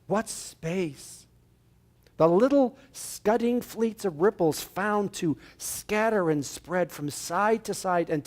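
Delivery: slow reflective reading; reading Emerson aloud. Acted. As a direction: read the journal slowly, savoring it. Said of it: "I want to read something".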